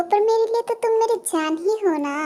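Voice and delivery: high, sweet lilting coo; lovestruck cooing. Acted. Girl calls the mafia king her darling, cooing.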